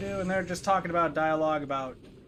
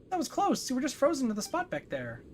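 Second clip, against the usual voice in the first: high-pitched little companion; Paimon, relieved; 9 semitones higher than usual. Acted. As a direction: speak up in a high character voice. Voices game character Paimon.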